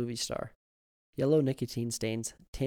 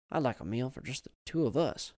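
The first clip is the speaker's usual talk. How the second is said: plain reading voice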